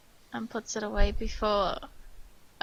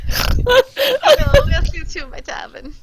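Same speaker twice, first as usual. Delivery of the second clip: much higher and louder, breaking into laughter; camp tiefling laughing; 13 dB louder than usual. Acted. Machi welcomes the party to his tavern, laughing through a high camp voice. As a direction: greet them grandly while cracking up. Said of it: "that's the wrong accent".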